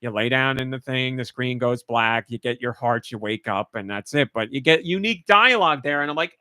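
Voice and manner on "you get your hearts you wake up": ordinary talk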